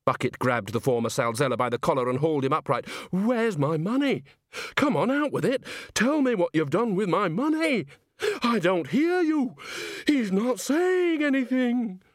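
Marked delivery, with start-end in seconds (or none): 3.1-12.0 agitated frantic manager. Acted. Bucket shakes the corpse.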